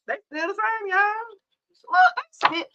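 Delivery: exasperated squeal. Real, not acted. Exasperated at the reading.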